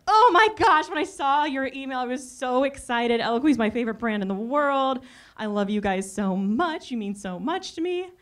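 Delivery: high, excited squeal easing into gushing praise; gushing fan. Retold or imitated. Customer thrilled to hear from her favorite brand, gushing.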